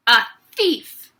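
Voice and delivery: loud accusing scream. Acted. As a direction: accuse him before everyone. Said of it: "the Trunchbull screamed".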